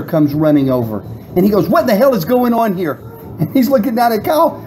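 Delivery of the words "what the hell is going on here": raised-pitch mock challenge; lightly hammed-up irate bouncer; imitated demanding bouncer. Bouncer confronts the group.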